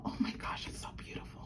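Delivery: hushed, breathless whisper; awed whisper. Real, not acted. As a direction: whisper it like it might break. Admiring the bangle.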